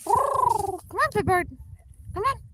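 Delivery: high, lilting call to a bird; high coaxing call. Real, not acted. Owner coaxing her goose to come: high sing-song call.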